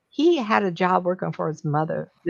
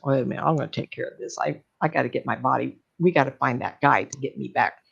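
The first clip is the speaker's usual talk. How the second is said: lower, fast determined cat voice; Joe as the cat; 6 semitones lower, faster than usual. Retold or imitated. Joe stuck in the cat, lower and fast, taking charge. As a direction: rattle it off low and quick, taking charge.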